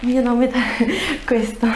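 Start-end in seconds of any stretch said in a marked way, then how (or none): 0.7-1.2 brief embarrassed giggle; a quick high laugh; light laugh breaking the answer; short laugh. Real, not acted. Patient laughs mid-answer.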